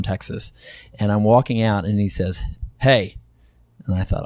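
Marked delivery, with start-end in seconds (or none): 2.8-3.2 short, louder "hey"; curt call to get someone's attention. Retold or imitated. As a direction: stop an underling with one word. Boss calls out to assistant.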